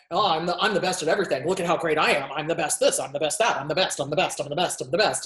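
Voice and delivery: fast, smug bragging; boastful grandiose voice. Retold or imitated. Therapist mocking the grandiose narcissist, bragging fast. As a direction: brag like you're the best at everything. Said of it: "the one that's all".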